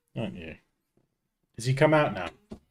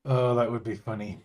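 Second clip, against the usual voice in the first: normal talking voice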